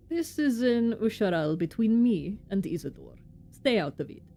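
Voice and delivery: put-on amateur Russian-accented foreman; bad Russian accent. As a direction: play him with a clumsy Russian accent. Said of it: "my very bad Russian accent".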